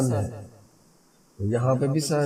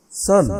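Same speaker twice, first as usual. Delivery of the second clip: सन high with full stress; fully stressed, high सन; word said alone, stressed; 6 semitones higher than usual. Shown as a demonstration. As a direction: hit सन as a standalone word. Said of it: "अकेला जब बोले".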